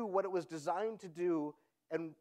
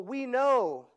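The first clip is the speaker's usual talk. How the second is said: usual speaking voice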